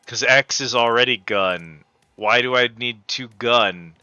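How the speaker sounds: usual speaking voice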